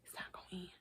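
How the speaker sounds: whispered It's not gonna end. Real, not acted. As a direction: breathe it out voiceless.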